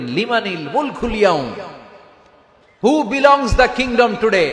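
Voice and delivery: a spoken take, short of a full roar; roaring question. Acted. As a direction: stretch the question out like a challenge. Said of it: "আল্লাহ তখন হুঙ্কার দিয়ে বলবেন".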